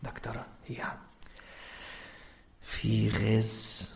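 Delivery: hushed, low whisper, barely voiced; whispering man. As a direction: lean in and whisper it like a nervous man. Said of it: "الراجل ده جاي كده".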